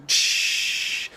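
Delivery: long "shh" like escaping steam; steam release hiss. Pretend. Teacher imitating a steam engine venting stress, long hiss. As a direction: let off pressure with a drawn-out hiss. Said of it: "let out the stress".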